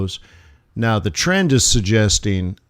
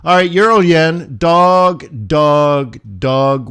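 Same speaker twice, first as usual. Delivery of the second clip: loud disgusted verdict; loud scornful dismissal; disgusted call; 4 semitones higher, 5 dB louder, slower than usual. Real, not acted. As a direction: spit out "dog" at the chart. Disgusted with chart.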